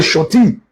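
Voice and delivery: "shorty" with an aw vowel and no middle syllable; mispronounced "shorty". Shown as a demonstration. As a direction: say "shorty" in two syllables, SHAW-tee. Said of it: "pronunciation of that word is surety".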